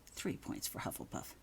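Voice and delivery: soft whispered aside, no voice; hushed whisper of good news. Acted. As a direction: breathe it as a secret aside. Aside announcing house points.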